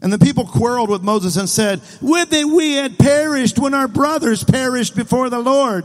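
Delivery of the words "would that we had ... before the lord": high, loud complaining whine; put-on whiny voice, pitched up. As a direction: whine it out like aggrieved grumblers. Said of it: "You get the whiny voice?".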